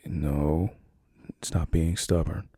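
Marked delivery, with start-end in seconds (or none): none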